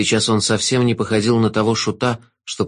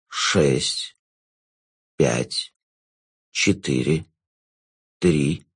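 low, slow, velvety jester; sinister jester; 8 semitones lower, 4 dB quieter, much slower than usual. Acted. The Jester counting.

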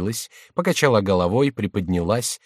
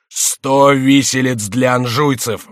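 loud, slow royal cry; king's exclamation; 4 semitones higher, 7 dB louder, slower than usual. A narrator's reading. King exclaiming against the Angevins. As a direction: proclaim the gallows. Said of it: "восклицал король".

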